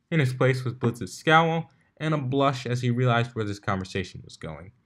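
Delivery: ordinary delivery, nothing marked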